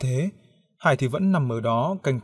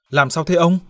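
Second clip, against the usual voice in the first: narrated irritation, higher and louder; annoyed question; 5 semitones higher, 5 dB louder than usual. A narrator's reading. Roommate woken by the scream asks, annoyed. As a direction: ask it crossly, pitched up. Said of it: "hỏi giọng bực tức".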